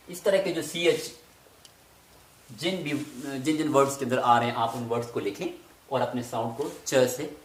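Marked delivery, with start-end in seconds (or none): none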